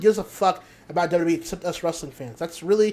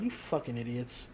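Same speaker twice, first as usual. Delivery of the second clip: much softer, scornful under the breath; muttered insult; 9 semitones lower, 15 dB quieter than usual. Real, not acted. Scorning wrestlers who fell for the bait, muttered insult. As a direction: mutter the insult half to yourself.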